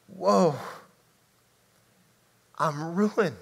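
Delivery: ruined woe. Shown as a demonstration. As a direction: let it sink, undone before God. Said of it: "Not even whoa".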